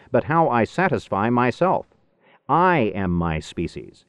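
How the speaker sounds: usual speaking voice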